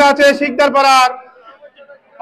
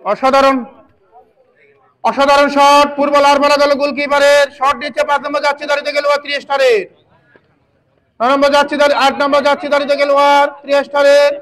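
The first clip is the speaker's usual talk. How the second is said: fast excited match commentary; frantic football commentary. Real, not acted. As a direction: call the action live, urgently. Live play-by-play commentary.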